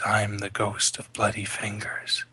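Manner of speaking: slow ghostly whisper; eerie whisper. A narrator's reading. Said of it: "the daughter hears the voice".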